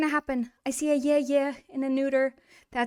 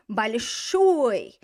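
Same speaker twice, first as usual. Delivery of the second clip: большой with a long, falling last syllable; большой, second syllable stretched and stressed; stress on final syllable; 5 dB louder than usual. Shown as a demonstration. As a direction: lean hard on the end of большой. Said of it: "the final syllable".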